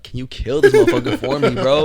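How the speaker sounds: high, loud mock plea to God. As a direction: plead it high, half laughing. Acting out a prayer to God.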